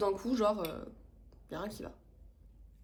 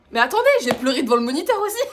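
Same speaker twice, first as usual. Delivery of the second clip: loud, higher, breaking into laughter; laughing confession; 4 semitones higher, 12 dB louder, faster than usual. Real, not acted. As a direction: blurt it out while cracking up. Laughing as she confesses crying.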